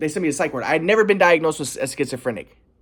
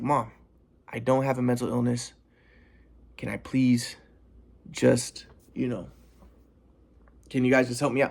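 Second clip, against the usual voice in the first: much softer, low, steady pleading; super calm; 5 semitones lower, 5 dB quieter than usual. Retold or imitated. Son pleading quietly with his screaming mom. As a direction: stay very calm and soft with her. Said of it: "I'm being super calm".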